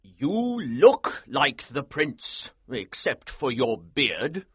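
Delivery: very slow, considering colonel voice; measured, weighing each word; said slowly. A narrator's reading. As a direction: draw the words out deliberately. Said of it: "Colonel Sapt said slowly".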